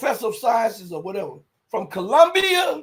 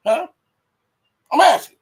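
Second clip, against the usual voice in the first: loud challenge; 8 semitones higher, 9 dB louder than usual. Real, not acted. Challenging the viewers.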